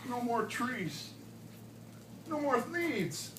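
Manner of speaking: ordinary delivery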